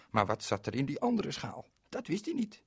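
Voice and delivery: ordinary delivery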